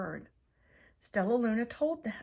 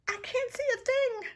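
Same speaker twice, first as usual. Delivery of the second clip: much higher, louder squeal; panicked yell; 5 dB louder than usual. A narrator's reading. Pip the bird flying blind at night, panicked high yell. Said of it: "yelled Pip".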